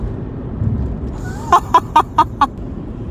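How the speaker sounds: high, breathy staccato cackle; high laugh. Real, not acted. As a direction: burst of rapid high laughter. Her own laugh between remarks.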